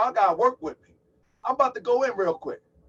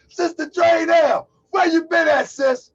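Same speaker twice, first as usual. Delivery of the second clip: high, loud shouted greeting; excited call-out; 9 semitones higher, 10 dB louder, slower than usual. Real, not acted. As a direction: call out to her across the room. Calls out to arriving viewer.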